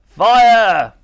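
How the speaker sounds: loud, high military order; shouted command. A narrator's reading. The Admiral orders Titch to fire, shouted command. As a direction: bellow the firing order.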